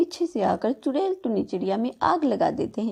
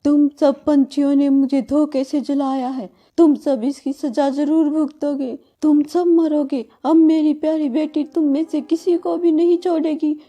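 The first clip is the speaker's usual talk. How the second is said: loud, higher witch's vengeful curse; furious curse; 4 semitones higher, 6 dB louder than usual. Acted. Dying witch curses all the birds, loud and furious.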